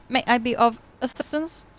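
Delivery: put-on old speech synthesizer. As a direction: speak like a polite old robot. Voicing the robot.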